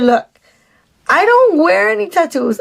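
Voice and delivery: usual speaking voice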